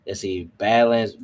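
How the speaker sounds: ordinary delivery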